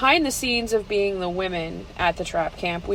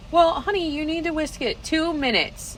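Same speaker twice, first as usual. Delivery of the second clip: patient honey; 8 semitones higher than usual. Retold or imitated. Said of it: "And you're like".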